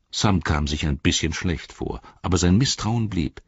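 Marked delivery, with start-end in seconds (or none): none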